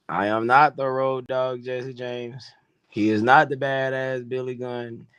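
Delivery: usual speaking voice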